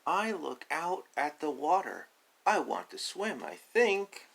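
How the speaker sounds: high, loud, slow hesitant duck; high unsure duckling voice. Acted. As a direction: wonder aloud as the little duck. Duckling deciding to swim.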